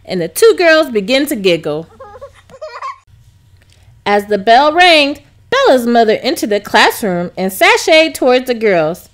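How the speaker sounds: usual speaking voice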